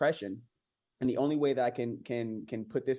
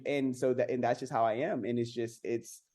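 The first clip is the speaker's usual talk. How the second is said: ordinary talk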